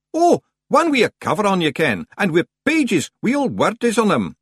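high, loud Scots voice; Scots mysterious stranger. Acted. Stranger describing a book to the librarian, high broad Scots.

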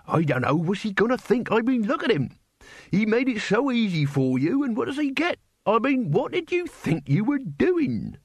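high, indignant scolding; an older man berating his apprentice. A narrator's reading. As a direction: scold the boy in disbelief.

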